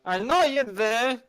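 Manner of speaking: put-on drunken yelling. Drunk man keeps knocking for his little friend, yelling. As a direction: shout through the door, stretching the words. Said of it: "all this drunken yelling".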